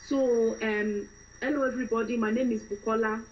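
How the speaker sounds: fresh-from-Nigeria woman introducing herself; Nigerian accent. Shown as a demonstration. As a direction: greet everyone in a Nigerian accent. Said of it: "start off with a fresh Nigerian woman".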